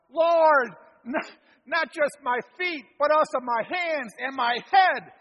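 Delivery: loud, high all-or-nothing outcry; cries out. Acted. Peter begging Jesus to wash him wholly, loud. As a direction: Peter crying out eagerly. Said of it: "he cries out".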